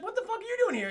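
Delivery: high-pitched acted outburst; incredulous challenge. Confronting the villain who walks back in, incredulous challenge. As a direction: pitch it way up in mock shock.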